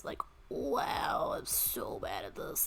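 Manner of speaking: unvoiced mock-shamed whisper; low hushed self-mockery. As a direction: breathe it out like a confession.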